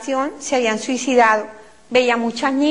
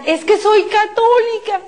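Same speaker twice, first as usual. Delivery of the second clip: high, slow pleading cry; high drawn-out desperate plea; 8 semitones higher than usual. Retold or imitated. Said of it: "a gritar".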